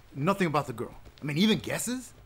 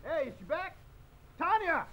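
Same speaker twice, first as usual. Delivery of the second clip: high, far-off voice; faint, raised-pitch calling out; distant call; 11 semitones higher, 6 dB quieter than usual. Acted. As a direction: call for someone down the hall. Calling for Tanya from afar.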